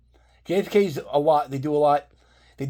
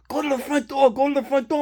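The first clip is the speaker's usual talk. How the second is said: imitating a trooper barking orders, higher; mock yelling through the window, higher pitch; retold cop shout; 10 semitones higher than usual.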